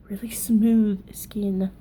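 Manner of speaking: slow breathy whisper on smooth skin. Pretend. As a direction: linger over it in a whisper. Dreamy aside about smooth skin.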